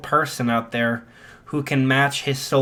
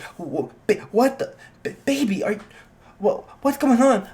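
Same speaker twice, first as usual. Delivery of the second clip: high, stammering alarm; freaked-out mom; 8 semitones higher than usual. Acted. Mom seeing blades on her son's hands, freaked out. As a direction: stumble over the words in startled high pitch. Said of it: "a little bit freaked out".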